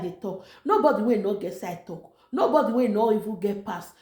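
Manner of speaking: usual speaking voice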